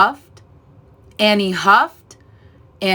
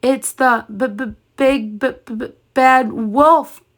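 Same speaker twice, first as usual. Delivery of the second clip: stammering with fear; chattering; 5 semitones higher than usual. A narrator's reading. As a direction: stutter on the b in fright. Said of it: "Boris chattered".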